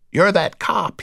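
high, loud rasped accusation; raspy recognition. A narrator's reading. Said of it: "in his newly raspy voice".

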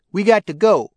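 put-on a girl's loud, high-pitched demand; loud young woman. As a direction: call out for Cottontail impatiently. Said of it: "talking to him in a loud voice".

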